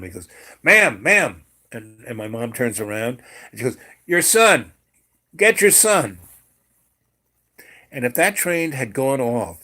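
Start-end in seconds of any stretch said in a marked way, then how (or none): 0.6-1.4 loud, raised-pitch hail; acted policeman shouting after someone; calling out. Policeman calls after the mother. 4.1-6.2 loud, high acted shout; imitated insistent yell; urgent calling out. Acted urgent call about the child.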